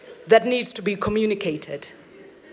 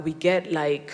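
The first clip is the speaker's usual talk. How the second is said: ordinary talk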